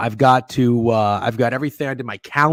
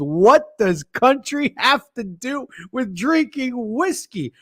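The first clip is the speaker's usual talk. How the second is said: mock dumb quote; 10 semitones higher, slower than usual. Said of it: "and says".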